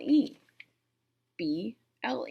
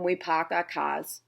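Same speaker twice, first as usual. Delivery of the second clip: R-less Boston "Pac our Kaz"; Boston accent; 2 semitones lower than usual. Shown as a demonstration. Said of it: "we don't believe in R's".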